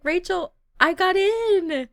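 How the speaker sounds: high, loud burst of news; excited. Acted. Diana telling her roommate, thrilled and loud. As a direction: blurt out the good news, thrilled.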